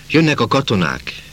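high-pitched but contained cry; shouted warning. A narrator's reading. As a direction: call out a warning to the crowd. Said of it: "kiáltotta valaki". (magas hangú, figyelmeztető kiáltás)

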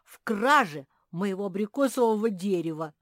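loud, high accusing yell; wailing yell. A narrator's reading. Bear cub accusing squirrel of theft, yelling. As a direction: yell the charge out loudly. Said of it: "завопил медвежонок".

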